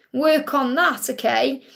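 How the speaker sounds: ordinary delivery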